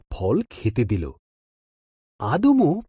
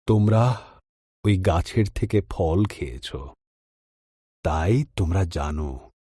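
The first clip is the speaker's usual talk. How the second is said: weary sighing God; 6 semitones lower than usual. A narrator's reading. God sighing at Adam and Eve's disobedience, lower and sorrowful. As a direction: let disappointment weigh each word down. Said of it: "ঈশ্বর দীর্ঘশ্বাস ফেলে বললেন".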